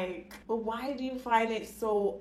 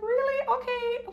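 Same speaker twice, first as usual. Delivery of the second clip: much higher, bright surprised reply; 3 dB louder than usual. Retold or imitated. Answering customers who say she looks local, high surprised. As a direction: act surprised, pitch jumping high.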